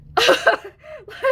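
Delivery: sudden high laugh breaking out, a little cry in it; high burst of laughter. Real, not acted. Telling viewers about a game twist, bursts into high laughter.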